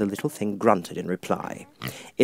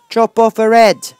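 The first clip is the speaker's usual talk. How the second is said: much higher, louder shouted order; furious Duchess shout; 12 semitones higher, 13 dB louder, slower than usual. Acted. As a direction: yell the sentence like a mad noble.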